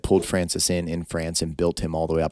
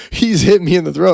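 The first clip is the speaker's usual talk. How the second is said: much higher and louder, hammed-up whine; mock whiny complaint; 12 semitones higher, 10 dB louder than usual. Opponent complaining to the ref, hammed-up high whine. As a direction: whine like a fighter tattling to the ref.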